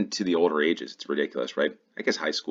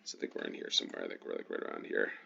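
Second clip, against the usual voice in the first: muttering to himself; 11 semitones lower, 10 dB quieter, faster than usual. Real, not acted.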